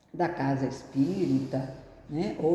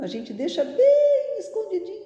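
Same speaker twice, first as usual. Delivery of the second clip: tucking it away; 6 dB louder, faster than usual. Acted. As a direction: stretch it high, as if stuffing something out of sight. Playfully mimes hiding the inner voice.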